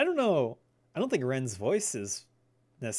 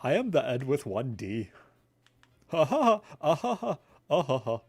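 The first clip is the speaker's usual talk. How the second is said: loud, dopey cartoon chuckles around the lines; goofy cartoon voice with big staccato laughs; 4 semitones higher, 4 dB louder than usual. Gamer obliges a request for a cartoon impression, goofy and chuckling. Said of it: "Can you do an Ed impression?".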